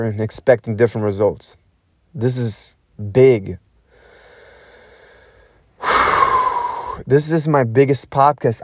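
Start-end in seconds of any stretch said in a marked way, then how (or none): 5.8-7.0 long whooshing breath out; forceful releasing sigh; big exhale. Real, not acted. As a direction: blow out hard before going on. Blows out breath before declaration.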